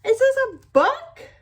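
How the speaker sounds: sing-song it to a little one. Real, not acted. Reading a book to toddler.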